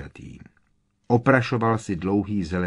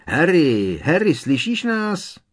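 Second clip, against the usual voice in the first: calling out; 9 semitones higher, 6 dB louder than usual. A narrator's reading. Calling out through boards.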